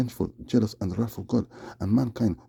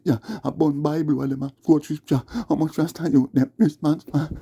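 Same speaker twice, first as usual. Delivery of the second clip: ranting patois; 5 semitones higher than usual. Acted. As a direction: rail at the fake rastas. Ranting patois, high and loud.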